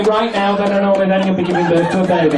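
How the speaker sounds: ordinary delivery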